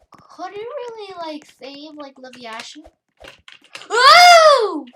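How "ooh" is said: loud, very high "ooh"; long high-pitched cry; high whoop. Real, not acted. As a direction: let out a piercing excited whoop. Excited cry during play.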